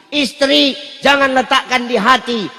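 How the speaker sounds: ordinary talk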